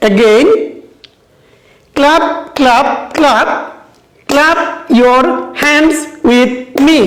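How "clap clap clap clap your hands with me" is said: slow word-by-word beat on "clap", "hands", "me"; stressed words clapped out. Shown as a demonstration. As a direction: punch "clap", "hands" and "me", a gap between words. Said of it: "I repeat it".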